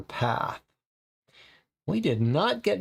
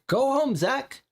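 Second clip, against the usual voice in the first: lady quoted; 8 semitones higher than usual. Retold or imitated. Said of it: "she just said".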